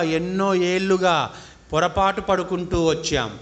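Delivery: slow, drawn-out meditation guidance; measured phrases with long settling pauses; guided meditation. Real, not acted. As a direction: lead them into breath awareness, unhurried.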